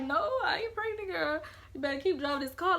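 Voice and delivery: high, loud protest; defiant denial. Retold or imitated. Teen telling mom she isn't pregnant, loud and defiant. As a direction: shoot it down hard, high and loud.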